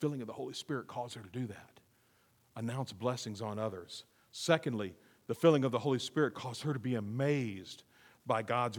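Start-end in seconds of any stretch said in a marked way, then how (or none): none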